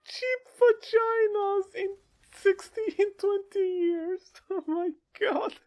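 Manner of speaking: high, squealing helpless laughter; real laughing fit, voice cracking high; crying with laughter. Real, not acted. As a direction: laugh so hard it breaks into tears. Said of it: "I'm crying".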